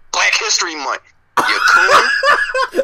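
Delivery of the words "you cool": laughing. Real, not acted. Teasing laugh after joke.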